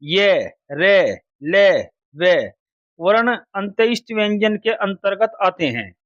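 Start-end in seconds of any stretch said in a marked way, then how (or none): 0.0-2.6 है and ए drawn out slowly, each sound held; slow sound demo. Shown as a demonstration. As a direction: stretch है and ए like a teacher showing the mouth position. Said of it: "अंत्यस्थ व्यंजन कहा जाता है".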